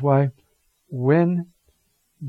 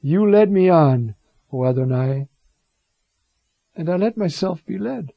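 Jeremiah's lament; 3 semitones higher, 5 dB louder than usual. Retold or imitated. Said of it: "So it starts".

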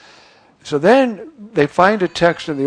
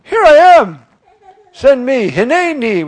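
much higher and louder, bursting with eagerness; eager volunteer; 9 semitones higher, 8 dB louder than usual. Acted. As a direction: leap forward like a man who can't wait to be chosen. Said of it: "And he said".

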